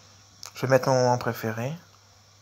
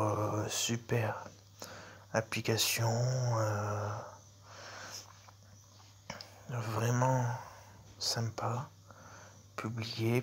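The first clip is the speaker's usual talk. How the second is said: murmuring while typing; 9 dB quieter, much slower than usual. Real, not acted. Typing a review while reading.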